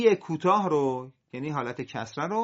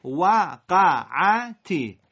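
وقعتی very slow and loud, syllables apart; 6 dB louder, much slower than usual. Shown as a demonstration. Teacher models the split reading of وقعتی before joining it. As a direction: chop وقعتی into slow beats. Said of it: "حالا سر هم".

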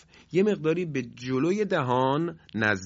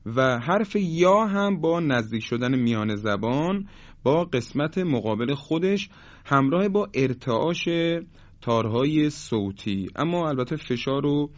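ordinary talk, nothing marked

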